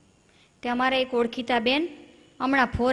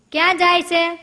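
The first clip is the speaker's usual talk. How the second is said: sharp demanding husband; 5 semitones higher, 6 dB louder than usual. Retold or imitated. Husband demands where wife goes.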